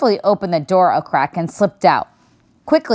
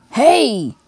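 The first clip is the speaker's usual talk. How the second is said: startled bear; 6 semitones higher than usual. A narrator's reading. Bear hit by seed cake.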